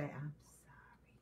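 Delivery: slow, barely audible whisper; reluctant whispered apology. Retold or imitated. Wife who hates saying sorry to her husband, reluctant whisper. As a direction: squeeze out the apology under your breath. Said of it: "It be so hard to say I'm sorry".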